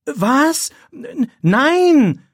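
high, loud stammered cry of denial; shocked. A narrator's reading. Pupil hears he is accused of cheating, shocked outcry. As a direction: cry out in alarm, voice leaping up. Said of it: "Philipp erschrickt".

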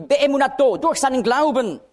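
"Beemunato" lifted high and firm; stressed Hebrew word. Shown as a demonstration. Preacher showing Galater's accent, high stressed word. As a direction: ring out "Beemunato, durch seinen Glauben". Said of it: "jedes Mal wird der Akzent auf ein anderes Wort gelegt".

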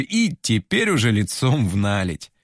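plain reading voice

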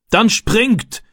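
high, urgent call to act; shouted command. A narrator's reading. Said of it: "rief der Mann". (gerufener Befehl, hoch und dringlich)

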